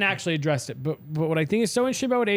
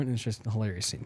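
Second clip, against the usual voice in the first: normal talking voice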